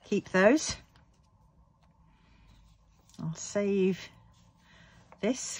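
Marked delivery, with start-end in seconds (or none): none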